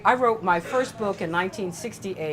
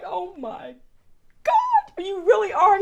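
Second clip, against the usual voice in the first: much higher and louder as it builds; overwhelmed disbelief; 13 semitones higher, 4 dB louder, slower than usual. Retold or imitated. Retelling the saddle gift, rising into overwhelmed disbelief. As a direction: let it climb high, overwhelmed. Given her late brother's saddle.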